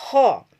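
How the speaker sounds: Arabic ho with tafkim; thick ho demo, loud. Shown as a demonstration. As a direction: push out a heavy tafkim ho. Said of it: "Tenggorokan menjadi sempit seperti ini".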